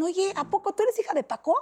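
a lightly voiced child taunting; taunting kid. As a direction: needle her like a bully. Kids taunting her daughter.

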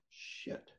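a hushed, voiceless swear; whispered curse. Retold or imitated. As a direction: breathe the word out so nobody wakes. Whispering in bathroom, afraid to wake.

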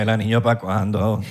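slow, low mechanical robot. Pretend. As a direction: say it like a toy robot. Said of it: "Como un robot".

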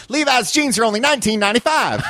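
high, feminine Southern twang; a high Southern kid reading an ad; deep south accent. Retold or imitated. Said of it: "how Southern and feminine I thought I sounded".

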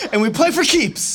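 high laughing, slowed down. Real, not acted. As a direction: giggle through the words. Laughing through the line.

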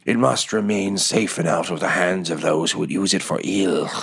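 lightly voiced creature, solemn and earnest. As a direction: speak as a creature gravely entrusting a treasure.

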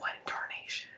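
put-on whispered old prospector line. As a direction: breathe the words out. Whispered Southern phrase.

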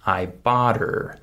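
"her" without H, joined to "bought"; dropped H. Shown as a demonstration. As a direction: link "bought her" into "bodder". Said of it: "I skip the H sound".

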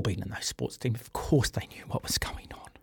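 whispered aside, almost no voice; knowing whisper. Real, not acted. Host drops to a whisper about the teammates, hushed. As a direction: let it slip out as a hushed aside.

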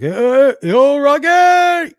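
high, slow, slurred Rocky voice; hammed-up Rocky Balboa; Stallone impression. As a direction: drawl it out like Rocky. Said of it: "looked like Stallone's lip".

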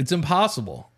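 usual speaking voice

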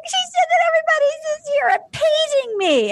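very high, crying whine; imitating Claire in a high, tearful wail; mock crying Claire. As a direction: whimper it like a hammed-up crier. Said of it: "She's like".

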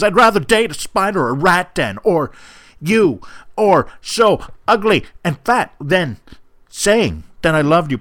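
hammed-up angry breakup letter read aloud; dramatic reading. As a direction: read it like a furious teen dumping her boyfriend. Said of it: "there have been dramatic readings".

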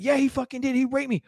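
high, worked-up mock accusation; insistent victim. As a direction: imitate a man protesting to the officer.

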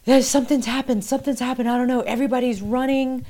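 retelling the ex's frantic call; panicked. Retold or imitated. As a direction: blurt it out like you're running for your life. Ex panicking on the phone.

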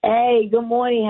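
ordinary talk, nothing marked

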